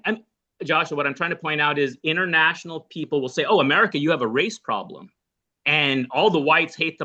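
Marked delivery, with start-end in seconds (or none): none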